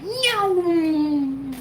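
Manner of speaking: high, drawn-out cartoon meow; mock cat meow. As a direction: squeak out a long cat meow. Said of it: "the cats were like".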